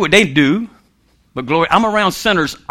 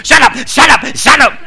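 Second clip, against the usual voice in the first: higher, insistent mock shouting; mock owner's yell; 5 semitones higher than usual. Dog owner yelling at the barking dog, mock shout. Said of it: "louder than the dog".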